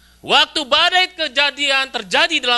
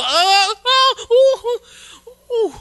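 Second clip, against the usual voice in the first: high, loud imitated screaming of a scared child; child's night screams; 13 semitones higher, 8 dB louder, much slower than usual. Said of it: "Teriak-teriak". (jeritan anak yang mimpi buruk, tinggi dan keras)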